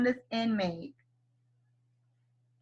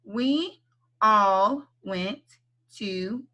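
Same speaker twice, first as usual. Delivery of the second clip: very slow "We all went to eat", word by word; slow read; much slower than usual. Shown as a demonstration. Teacher reads the sentence very slowly for kids. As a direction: drag each word out for the kids. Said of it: "then I want you to read it with me".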